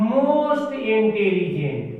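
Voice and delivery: very slow, word by word; drawn-out teacher dictation. Shown as a demonstration. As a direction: spell it out slowly as you write on the board. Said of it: "और इसके बाद है आपका".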